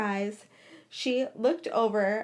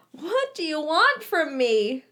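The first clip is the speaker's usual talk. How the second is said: whimpering; 5 semitones higher, 5 dB louder than usual. A narrator's reading. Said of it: "she whimpered".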